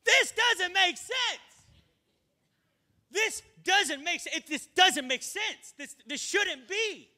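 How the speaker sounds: high, slow, bewildered cry; high, drawn-out confusion; confused soldier. Acted. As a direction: play a baffled soldier.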